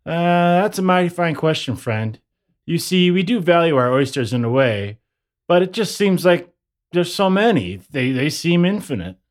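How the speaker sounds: put-on folksy, easygoing old-time New Yorker; lightly voiced cheerful local. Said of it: "a New Yorker around the turn of the twentieth century".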